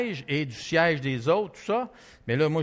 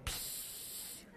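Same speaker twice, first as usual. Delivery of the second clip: soft imitated snake hiss; 20 dB quieter than usual.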